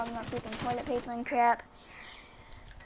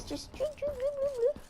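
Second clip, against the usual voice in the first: tiny high voice on each drip, much softer; playful high-pitched drip imitation; high squeaky drips; 13 semitones higher, 5 dB quieter than usual. Imitating dripping blood in high squeaks.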